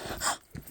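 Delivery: sharp surprised gasp; a quick gasp of relief. Real, not acted. Spots her RV after long walk.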